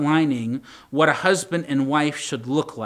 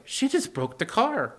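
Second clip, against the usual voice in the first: stunned inner thought; 8 semitones higher than usual. Retold or imitated. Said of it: "And I'm just like".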